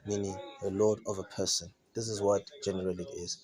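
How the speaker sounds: ordinary talk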